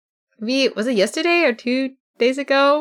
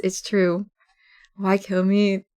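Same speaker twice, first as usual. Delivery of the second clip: ordinary talk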